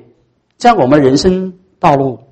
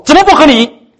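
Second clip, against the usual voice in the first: much higher and louder snap back; defiant protest; indignant retort; 13 semitones higher, 5 dB louder than usual. Retold or imitated. A believer snapping back at the Spirit, higher and louder.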